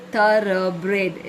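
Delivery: Thoroughbred slowly split as tho-rough-bred; three syllables. Shown as a demonstration. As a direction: sound out Thoroughbred, each syllable apart. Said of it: "is three syllables".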